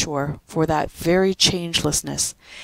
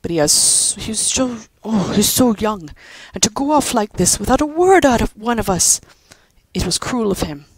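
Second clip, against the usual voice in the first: pained; 5 semitones higher, 4 dB louder than usual. Acted. Father upset about son leaving.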